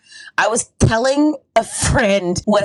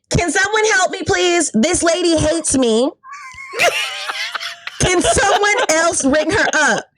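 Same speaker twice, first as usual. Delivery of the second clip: calling out for help; 8 semitones higher than usual. Retold or imitated. Store clerk, both hands up, appeals for help, calling out. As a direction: call for rescue in a high, stretched voice. Calls out for help in retold scene.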